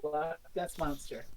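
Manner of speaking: very quiet, low and slow; deflated disbelief. Real, not acted. Co-host absorbing the absurd reveal, deflated. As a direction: sink to a drained, near-muttered repeat. Repeats the name, drained by disbelief.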